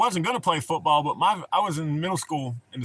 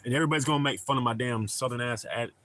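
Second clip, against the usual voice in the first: usual speaking voice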